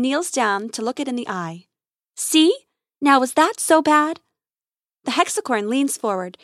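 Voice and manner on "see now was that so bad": higher, louder, pleased lilt; cheerful reassurance. A narrator's reading. Grace kneels to the treated creature, cheerful reassurance. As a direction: reassure it like a small child.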